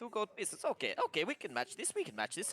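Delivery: excited dwarf. Acted. DM voicing dwarves.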